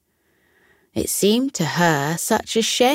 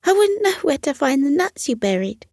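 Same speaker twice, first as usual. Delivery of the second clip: squirrel voice, higher and hurried; high, quick squirrel; 8 semitones higher, faster than usual. Acted. Squirrel worrying he cannot find the buried nuts, high and quick. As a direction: rush it a little, wistful. Cyril continues.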